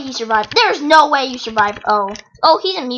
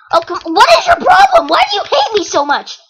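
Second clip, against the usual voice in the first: much higher, louder whiny complaint; mock outrage; 11 semitones higher, 4 dB louder than usual. Gamer to the opponent hitting him, whiny mock outrage. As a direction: play the wronged friend, over the top.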